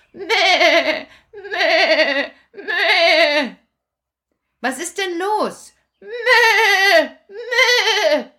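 high bleating goat. Acted. Goat answering Kasperl with high bleats.

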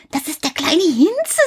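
put-on high little-boy voice; Häwelmann explaining. A narrator's reading. Häwelmann tells the moon about the cat, in a high child's voice. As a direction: tell it like a knowing child. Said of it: "sagte Häwelmann".

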